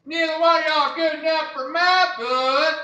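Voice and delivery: high, drawling Southern granny; imitated grandma with a Southern accent; Southern grandmother. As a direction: put on a high Southern old-lady voice. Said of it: "'Cause that's how she talks. She's from the South".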